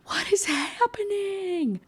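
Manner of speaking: slow, very high mock scream. Character waking up confused and yelling for help, mock screaming. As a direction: wail it high in drawn-out panic. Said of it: "she starts screaming again".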